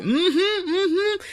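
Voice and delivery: squeaky high polite mm-hmms; forced agreement. Retold or imitated. Guests faking approval to the host, squeaky polite. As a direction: fake a polite yes, high and tight. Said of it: "we're like".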